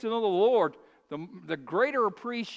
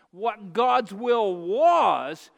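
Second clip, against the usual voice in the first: loud, slow, drawn-out proclamation; booming preacher emphasis; slow emphatic preaching; 7 dB louder, much slower than usual. Real, not acted. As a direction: hammer it home slowly from the pulpit. Preacher driving home the point.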